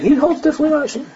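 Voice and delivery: high, fast disbelieving quote; high mocking disbelief; incredulous repetition. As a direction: rattle it off in high amazement. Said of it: "the whole day he's saying".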